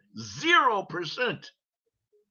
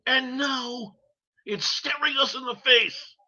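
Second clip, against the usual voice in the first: outraged shout; 8 semitones higher than usual. Real, not acted. Outraged rant.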